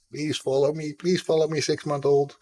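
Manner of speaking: high, pleading mock begging; playful pleading. Streamer begging a six-month-old to follow, playful pleading. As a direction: beg playfully, pitched well up. Jokingly begs a baby to follow.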